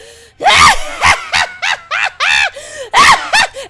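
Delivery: high, loud drawn-out cackle; flame skull cackle. Acted. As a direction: cackle on and on in triumph. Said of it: "the cackling of the flame skull".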